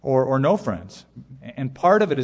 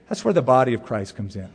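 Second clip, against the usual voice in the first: ordinary talk, nothing marked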